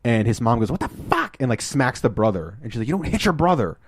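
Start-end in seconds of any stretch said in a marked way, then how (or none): 0.7-1.4 squeaky mock outrage; mock outraged mom. Retold outraged mom scolding her son. 2.9-3.8 higher-pitched imitation of a telling-off; hammed-up motherly reprimand; mock scolding mom. Retold mom scolding her son.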